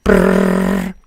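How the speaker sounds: low buzzing phone ring sound. Pretend. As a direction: imitate a phone ringing. Skit of a phone call.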